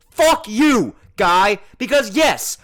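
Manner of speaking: furious outburst at the man; angry shout. Real, not acted. Cursing the man who lied.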